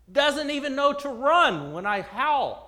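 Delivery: a wolf fuming to himself; angry wolf. Acted. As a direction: scoff at the sheep's nerve. Wolf fumes at the sheep.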